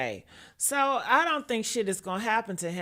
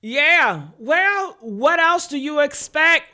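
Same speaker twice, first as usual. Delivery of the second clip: normal talking voice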